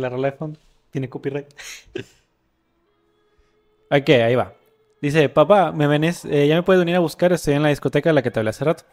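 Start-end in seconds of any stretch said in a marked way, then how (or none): 5.3-8.8 louder, voicing the son's texts; the son texting his dad from a disco, read out loudly; dramatic story reading. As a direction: read the chat story aloud with energy. Reading chat story aloud.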